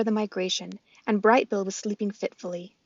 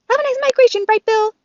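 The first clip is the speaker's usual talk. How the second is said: squirrel voice; 10 dB louder than usual. Acted. Chit Chat the squirrel.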